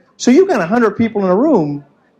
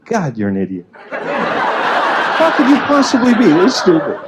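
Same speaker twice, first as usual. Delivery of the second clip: scolding sister; slower than usual. Retold or imitated.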